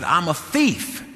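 slow, drawn-out imitated confession; tearful confession, retold. Woman after crying confesses to preachers, retold tearful confession. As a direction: draw out her admission, lightly imitating her. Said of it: "get her voice where she could say".

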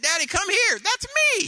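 put-on very high, loud little boy crying out; preacher imitating his grandson's delighted yell; excited toddler shout. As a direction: shout it like a thrilled three-year-old. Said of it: "he said".

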